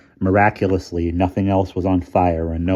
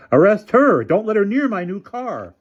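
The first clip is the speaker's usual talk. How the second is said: high, louder demand; frantic demand; 14 semitones higher, 6 dB louder than usual. A narrator's reading. Developer shouting at police to arrest her, high and loud. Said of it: "shouted the developer".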